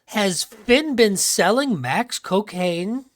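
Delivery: drawn out, very slow; slow suspicious question. Acted. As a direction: stretch each word in suspicion. Mock shocked question.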